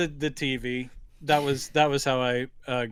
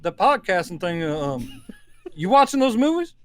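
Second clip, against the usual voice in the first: Southern dad voice, a touch higher; impression of his father; 6 semitones higher than usual. Son impersonating his proud Southern father, light Southern voice. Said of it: "he talks more like this".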